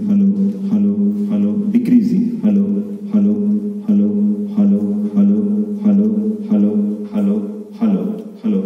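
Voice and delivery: amplified mic test as the bass comes down; mic check through the amplifier. Shown as a demonstration. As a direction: keep testing the mic over the speaker while turning it down. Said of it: "Now decreasing".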